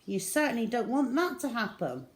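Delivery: ordinary delivery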